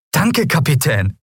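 high, drawn-out happy thanks; delighted. A narrator's reading. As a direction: thank them with boyish glee. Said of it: "Er stürmte freudig zur Theke".

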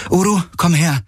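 high-pitched calling command; calling an animal. A narrator's reading. Kirai summons Uru to come, calling out. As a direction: call the animal over, pitch lifted.

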